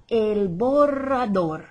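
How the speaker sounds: slow, loud "borrador" with an exaggerated trill; exaggerated rolled R's. Shown as a demonstration. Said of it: "I'm just exaggerating".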